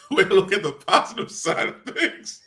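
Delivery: laughing through the words; giggling while speaking. Real, not acted. Laughing at the friend's comment.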